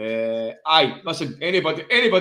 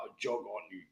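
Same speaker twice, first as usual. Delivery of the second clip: Eton toff; 5 semitones lower, 14 dB quieter than usual. Retold or imitated.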